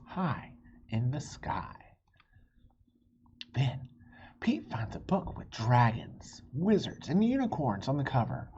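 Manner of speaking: gentle storybook reading voice; story narration. A narrator's reading. Reading a picture book to young listeners, gentle narration. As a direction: read it like bedtime story time.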